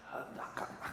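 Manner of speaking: tiny whispered mock reluctance; shy refusal. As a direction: whisper it like a mortified bride. Shy bride refuses, acted out.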